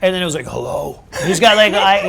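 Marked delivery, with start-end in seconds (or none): 0.5-1.0 low baritone hello, imitating a singer; mock deep Clint Eastwood-style greeting. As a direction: answer the phone down in a baritone. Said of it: "he's got a low voice".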